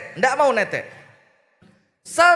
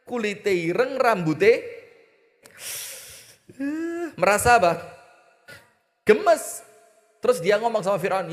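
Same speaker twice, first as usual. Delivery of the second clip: ordinary talk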